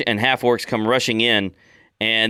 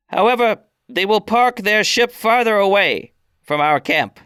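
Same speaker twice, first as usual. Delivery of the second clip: put-on old orc elder decreeing; 10 semitones higher than usual. Elder pronounces her decision to the outsiders, high and solemn. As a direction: hand down the clan's ruling. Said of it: "Digu ... and she says".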